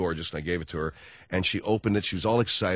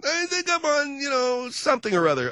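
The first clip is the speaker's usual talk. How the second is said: wife's voice, high. Retold or imitated. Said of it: "wife voice".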